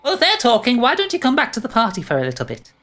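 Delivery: high lilting pixie voice; sing-song tempting fairy. Acted. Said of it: "pixies kind of float up behind you and go".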